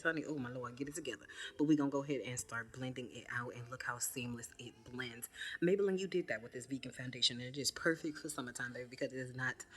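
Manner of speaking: ordinary delivery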